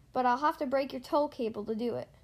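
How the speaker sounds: ordinary delivery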